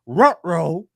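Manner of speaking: loud Scooby-Doo style ruh-roh; cartoon-dog uh-oh. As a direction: play it like a cartoon dog caught out. Cartoonish reaction to bad news.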